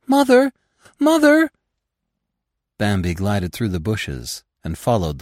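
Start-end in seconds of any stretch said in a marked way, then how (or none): 0.1-1.5 high, loud wailing cry; wailing call. Acted. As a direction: call out desperately for mother. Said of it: "Fawns' voices were wailing".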